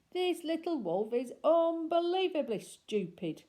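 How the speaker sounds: girl mocking the gullible wolf; scornful bully. A narrator's reading. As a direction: sneer it out. Girl's mocking thought.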